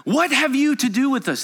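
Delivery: preacher shouting the demon's words. Acted. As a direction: hurl the question like a cornered demon. Loud demon voice.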